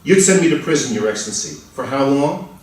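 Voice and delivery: normal talking voice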